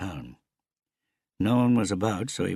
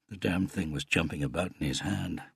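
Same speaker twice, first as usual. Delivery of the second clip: ordinary delivery, nothing marked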